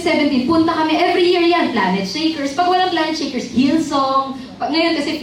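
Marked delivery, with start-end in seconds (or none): none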